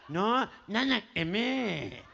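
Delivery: put-on low, nasal, sobbing gangoso boy; mock crying. Boy explains to his mother, mock-tearful gangoso voice. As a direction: blubber through the nose in broken, repeated bits. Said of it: "el nene llega llorando".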